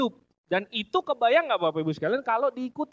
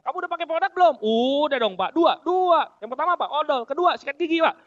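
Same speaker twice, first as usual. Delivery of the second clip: louder, higher acted back-and-forth; lively acted exchange; 5 semitones higher, 5 dB louder than usual. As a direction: play both sides of the chat with gusto. Acted coach-and-member chat. (dialog diperagakan dengan semangat)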